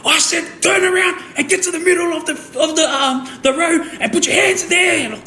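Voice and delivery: very high mock shout; imitated police blasting. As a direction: re-enact an officer blasting you with orders. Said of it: "he just friggin' blasted me".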